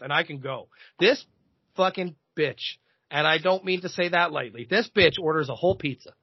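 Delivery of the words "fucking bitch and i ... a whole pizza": usual speaking voice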